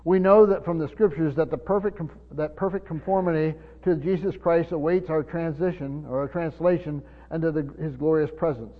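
ordinary delivery

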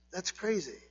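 soft, shaking-head echo; quiet disbelief; hushed repeat. Real, not acted. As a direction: murmur it back to yourself.

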